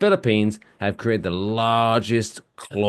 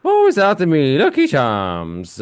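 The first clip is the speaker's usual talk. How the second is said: mock Irish accent; 6 semitones higher, 5 dB louder than usual.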